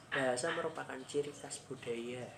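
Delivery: usual speaking voice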